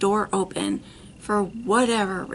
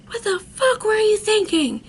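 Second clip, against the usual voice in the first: high, loud outraged yell; retold screaming; 13 semitones higher, 5 dB louder than usual. Dad and brother confronting the couple, retold screaming. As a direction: act out relatives screaming in outrage. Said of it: "screaming at him, screaming at me".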